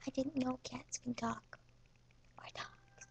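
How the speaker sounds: soft unvoiced whisper. Real, not acted. Kid whispering an aside to the camera. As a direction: confide it quietly.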